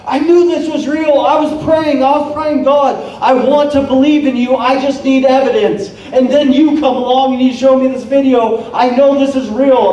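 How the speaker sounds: imitating an overjoyed new believer; excited convert. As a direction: gush like you just saw proof. New believer retelling his prayer.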